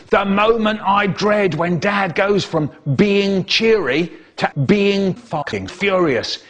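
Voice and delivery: ordinary talk, nothing marked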